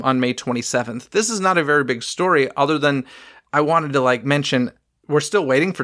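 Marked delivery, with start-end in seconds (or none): none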